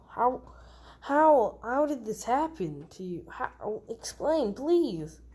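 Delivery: desperate to understand; distressed demanding. Acted. Bakugo demands to know what happened.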